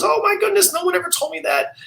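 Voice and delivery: put-on very high old-lady New York accent. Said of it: "And she goes".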